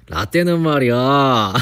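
put-on slow, loud, lecturing old-timer voice; mock kkondae elder. Teasing chat by playing a lecturing elder, slow and loud. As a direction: ham up the preachy boss. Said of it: "나 좀 꼰대 같았죠".

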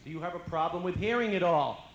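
doctor raising his voice to the patient; doctor repeating loudly. Retold or imitated. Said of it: "he repeats the question".

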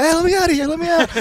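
very high, pleading cry to be let out; mock trapped worker. Worker sealed in a porta potty, high mock plea. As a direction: ham up a guy stuck in a porta potty.

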